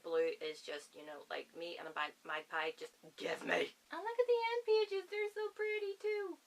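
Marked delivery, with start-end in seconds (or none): 3.9-6.4 much higher, gushing over the pages. Real, not acted. Showing viewers the book's end pages, high gushing delight. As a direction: coo over how pretty it is.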